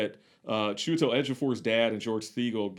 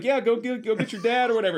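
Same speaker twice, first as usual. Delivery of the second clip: higher, fast, brushing-off voice; hurried dismissive order; 10 semitones higher, 3 dB louder, faster than usual. Retold or imitated. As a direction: rush the kid off, pitched up and quick. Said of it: "and he's like".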